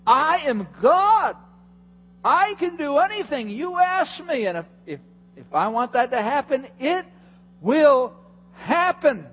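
high, loud, slow proclamation; Jesus declaring. Retold or imitated. Preacher voicing Jesus rebuking the disciples on the boat. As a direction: proclaim it with full authority. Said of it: "He's saying".

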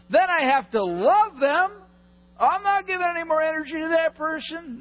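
high, loud disbelieving protest; squeaky shocked "you want me to what?"; incredulous objection. Acted. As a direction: play the outraged congregant who can't believe it. Preacher voices a shocked listener.

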